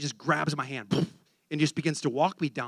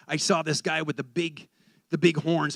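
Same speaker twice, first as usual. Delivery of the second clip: ordinary talk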